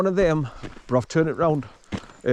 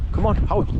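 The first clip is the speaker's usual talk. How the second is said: calling the dog; 7 semitones higher than usual. Real, not acted.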